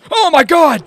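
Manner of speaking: sudden high, loud panicked yell; alarmed scream. Real, not acted. Player jumped by a goblin in the sewers, alarmed scream. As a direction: scream it out in shock.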